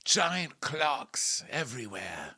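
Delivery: high, slow theatrical voice; hammed-up impression. Retelling her introduction of Nicolene, slow hammed-up impression. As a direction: draw it out grandly. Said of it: "when she first introduced Nicolene".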